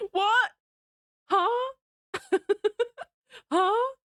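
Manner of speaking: real shock, half laughing; stunned disbelief. Real, not acted.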